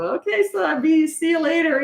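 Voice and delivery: high, flustered sing-song goodbye; embarrassed goodbye. Retold or imitated. Mother to the neighbors, a high embarrassed goodbye. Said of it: "I was all embarrassed".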